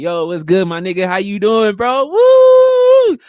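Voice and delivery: loud, high, hyped-up hello that ends in a whoop; excited greeting. Shown as a demonstration. Greeting a friend he really likes, loud and excited. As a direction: greet a close friend like you're thrilled to see him. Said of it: "you automatically like".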